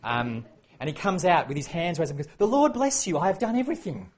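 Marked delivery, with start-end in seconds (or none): 2.4-4.1 high, loud, fake-cheerful greeting; falsely bright king; plastic smile. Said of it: "Saul's plastic smile".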